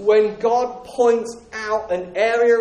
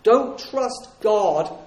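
stronghold voice; slower than usual. Acted. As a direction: draw them in slowly, falsely friendly. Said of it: "He says".

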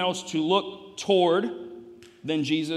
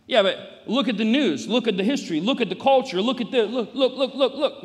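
high, loud, piled-up protest; insistent objection; 7 semitones higher, 6 dB louder than usual. Acted. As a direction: hammer each "look" like a heckler cutting in.

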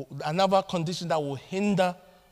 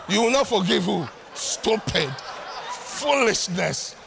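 put-on old African parent; slower than usual. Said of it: "Old African parent day".

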